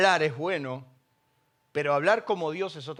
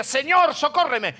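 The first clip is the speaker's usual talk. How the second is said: raised, louder pleading shout; retold cry for help; 7 semitones higher, 6 dB louder than usual. Woman begging Jesus for help, retold cry. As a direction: call out urgently as the desperate woman. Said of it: "Y ella gritaba y decía".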